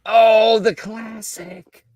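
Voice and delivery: mock reverent. Real, not acted. Greeting the beef ravioli.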